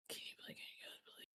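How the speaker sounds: hushed unvoiced secret whisper. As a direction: whisper it so nobody overhears. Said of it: "I'd be whispering".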